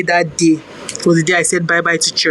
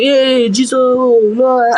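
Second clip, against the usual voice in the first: higher, half-acted church scream; mock scream; 5 semitones higher, much slower than usual. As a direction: cry out "Hey, Jesus, Lord" in mock fervour. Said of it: "I had to start screaming".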